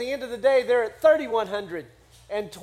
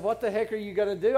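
quick, unperformed quote at a lower pitch; quoted in passing; 3 semitones lower, 4 dB quieter, faster than usual. Retold or imitated. Listener doubting the preacher's retelling, quoting it quickly and low. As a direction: rattle the question off as a citation. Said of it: "It doesn't say in my Bible".

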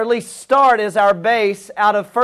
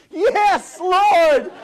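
high, hammed-up gleeful yes; comically overjoyed acceptance; eager delight; 8 semitones higher than usual. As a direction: play thrilled, pitch leaping way up. Woman offered George Clooney, accepts eagerly.

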